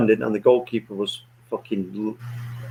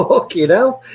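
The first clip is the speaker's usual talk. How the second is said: Fucking hell thrown up high in mock shock; shocked exclaim; 8 semitones higher, 4 dB louder, slower than usual. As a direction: pitch "Fucking hell" up high, a retold jolt of disbelief. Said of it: "I was like".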